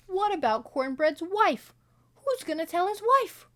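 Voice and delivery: high, loud alarmed questions; alarmed outcry. Retold or imitated. Viewer alarmed about Cornbread's widow, crying out high and loud. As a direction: cry it out in alarm. Her own worried outburst.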